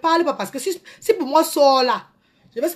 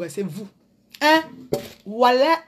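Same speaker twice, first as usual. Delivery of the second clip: usual speaking voice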